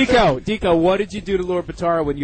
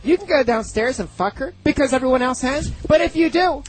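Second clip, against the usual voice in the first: much higher, mock-stern friend's voice; imitating a friend; 8 semitones higher than usual. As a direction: put on his higher voice, lecturing. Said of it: "you're doing my voice imitating your voice".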